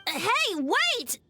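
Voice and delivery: high, wailing plea; protesting call. Acted. Bard calling after a friend about promised wine, protesting. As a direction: call after them, drawing out the complaint.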